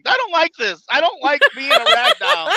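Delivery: high, loud, slow complaining whine; hammed-up frightened whine; mock whine. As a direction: whimper like a scared kid. Retelling his fear at being thrown.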